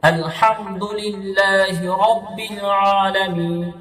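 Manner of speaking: drawn-out Quranic recitation, each sound clear; slow tajweed recitation. Shown as a demonstration. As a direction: recite slowly, stretching রাব্বিল. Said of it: "তার মানে কেমন পড়বো?". (ধীরে তাজবীদসহ তিলাওয়াত)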